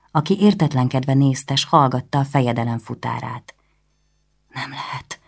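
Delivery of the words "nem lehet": barely audible, murmured to herself; quiet, to herself. Acted. Anna, stunned by the news, murmuring quietly to herself. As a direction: say it softly as if only to yourself. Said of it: "hajtogatta halkan, mint egy magának".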